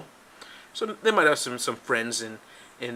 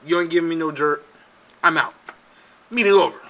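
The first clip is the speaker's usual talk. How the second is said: loud, higher, curt brush-off; mock dismissal; 4 semitones higher, 9 dB louder than usual. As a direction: play someone calling off a meeting. Acting out Don Junior walking out.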